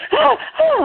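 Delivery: short, very high retold screams; mock scream. Patient screaming over the dropped pan, mock shriek. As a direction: squeal twice in mock screaming. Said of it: "I'm screaming".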